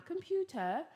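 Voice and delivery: light British accent. Shown as a demonstration. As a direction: say Computer the British way, crisp T.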